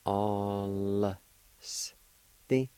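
"All" held long, then "s", then a short "Ti"; syllable by syllable. Shown as a demonstration. Teacher showing the L with the first A, each sound separated. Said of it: "like this".

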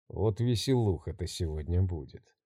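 low, soft sinister gloating. Acted. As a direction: savour it like a gleeful maniac. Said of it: "злорадствовал маньяк".

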